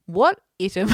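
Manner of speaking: "item" mispronounced with a short first vowel; misread item. Retold or imitated. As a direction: read "item" wrong with confidence, "ITT-um". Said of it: "And she says".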